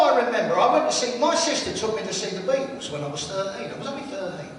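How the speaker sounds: ordinary delivery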